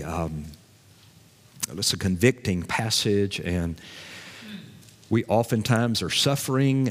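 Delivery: low, soft, halting prayer; hushed pastor leading a congregation in prayer; public prayer. Real, not acted. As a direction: pray humbly with thoughtful pauses. Leads closing prayer.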